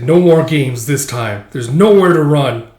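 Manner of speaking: loud, drawn-out villain threat; menacing threat. Acted. Monster corners the swordsman, loud menace. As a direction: boom it as a monster's warning.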